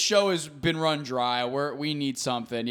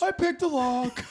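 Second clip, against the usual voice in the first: high put-on mimicking voice; imitated roommate; 10 semitones higher than usual. Frankie explaining the break-in to his roommate, high mimicked voice. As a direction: answer in a high mock voice. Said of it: "He goes".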